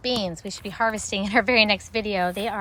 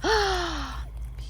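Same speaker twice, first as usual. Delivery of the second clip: delighted gasp; 7 semitones higher than usual. Real, not acted. Sees beautiful berries.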